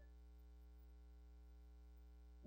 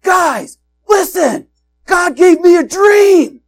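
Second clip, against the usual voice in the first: Joseph shouting his news, much higher and louder; excited shout; 20 dB louder, slower than usual. Retold or imitated. Joseph telling his brothers his dream, excited shout. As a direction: burst out with the big news. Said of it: "He's like".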